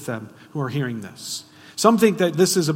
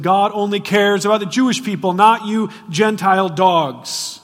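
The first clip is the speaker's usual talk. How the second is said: loud, sneering, higher voice of a smug religious leader; preacher voicing leaders who scorn Gentiles, loud and sneering; religious leaders' scorn; 5 semitones higher, 8 dB louder than usual. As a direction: bark it like a proud Pharisee shutting out outsiders. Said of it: "They would have said".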